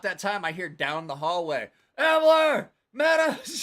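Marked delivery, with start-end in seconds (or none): 2.0-3.6 very loud, high-pitched holler; yelling names. Retold or imitated. Imitating someone calling friends down the hallway, yelling. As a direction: bellow it as if down a long hallway. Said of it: "just yelling".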